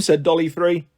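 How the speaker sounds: ordinary delivery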